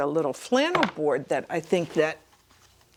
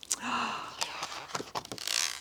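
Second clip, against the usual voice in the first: gasp; 6 semitones higher, 11 dB quieter than usual. A narrator's reading. Reader amazed.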